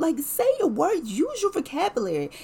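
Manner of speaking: high, loud, firm coaxing; firm mom voice. Retold or imitated. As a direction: demand he speak up. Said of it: "talking to him like ... my babies".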